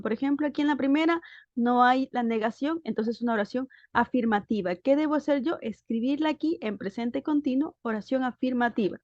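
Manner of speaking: ordinary delivery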